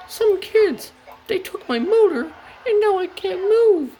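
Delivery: very high falsetto lament; upset Daisy. Acted. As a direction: wail the complaint in a squeaky high voice. Upset Daisy tells Thomas.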